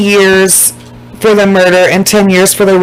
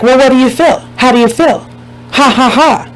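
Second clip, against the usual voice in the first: gloating mock reporter; 4 semitones higher than usual. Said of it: "trying to rub it in their face".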